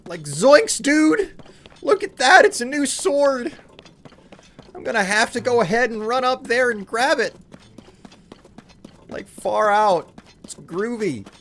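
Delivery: excited, very high, loud cartoon slacker; Shaggy. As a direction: exclaim in a squeaky-high goofy stoner voice. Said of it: "Shaggy from Scooby-Doo".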